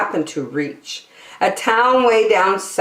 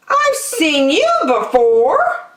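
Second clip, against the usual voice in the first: Maisie's voice; 10 semitones higher, 3 dB louder than usual. Acted. Maisie recognizes Horton.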